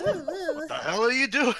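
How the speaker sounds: high indignant yell; mock yell. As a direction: shout in cartoon outrage. Mock screamed quote.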